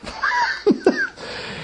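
a burst of high unvoiced laughter; high breathy laugh. Real, not acted. Preacher laughing at his own story, high and breathless.

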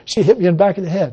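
ordinary talk

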